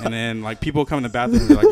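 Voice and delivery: ordinary talk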